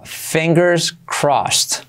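very slow fingers crossed; slow explained. Shown as a demonstration. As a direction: draw each word out. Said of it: "primero explicado lento". (despacio, palabra por palabra)

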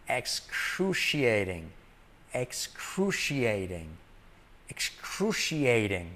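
"excruciating" said slowly, each syllable clear; slow repeated model word. Shown as a demonstration. Teacher drilling "excruciating" slowly for Spanish learners. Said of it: "vas a decir excruciating".

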